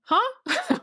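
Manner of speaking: totally thrown, squeak it out; baffled huh. Retold or imitated. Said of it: "And I'm like".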